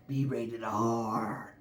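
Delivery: ordinary delivery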